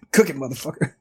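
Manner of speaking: laughing through a mock order. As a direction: chuckle it out as a joking command.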